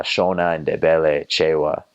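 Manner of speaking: usual speaking voice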